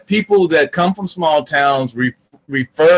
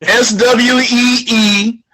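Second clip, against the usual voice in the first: slow, letter-by-letter spelling, higher; slow deliberate spelling, higher pitch; 6 semitones higher, slower than usual. Shown as a demonstration. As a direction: spell out S W E E dash P O T E slowly. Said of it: "spelling out".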